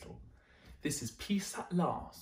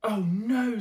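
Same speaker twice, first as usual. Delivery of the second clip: loud, high frustrated "oh no"; exasperated groan; 9 semitones higher, 9 dB louder than usual. Acted. Mister Bear woken by snoring, loud exasperated cry. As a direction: cry out at yet another noise.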